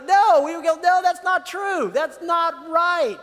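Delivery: high, loud, slowed refusal; rejecting a scam email with a loud, slow no; emphatic no. Retold or imitated. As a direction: shout down the lie.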